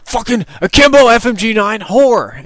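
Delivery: high, loud, slow furious yell; J-Hub cursing. Retold or imitated. Teammate cursing an enemy's akimbo guns, high furious yell. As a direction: spit out the insult in a mock rage. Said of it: "I just hear him go".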